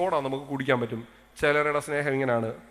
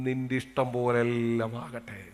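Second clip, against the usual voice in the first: slow, lower, softer gentle consent; respectful; 4 semitones lower, 4 dB quieter, slower than usual. Pretend. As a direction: yield to her respectfully. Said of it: "എന്തൊരു ബഹുമാനത്തിൻ്റെ ഭാഷയാണ്". (ബഹുമാനത്തോടെ പതുക്കെ)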